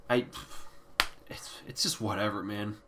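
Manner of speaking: very soft, low, deflated; upset, resigned. Real, not acted. Reviewer let down by disappointing albums, upset and resigned. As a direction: give up quietly, voice dropping away. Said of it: "I'm so upset".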